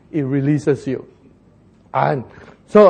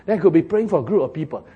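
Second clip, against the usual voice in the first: ordinary talk, nothing marked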